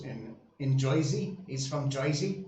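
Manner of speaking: hammed-up Jersey accent, "Joisey". Shown as a demonstration. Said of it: "that's my best Jersey accent".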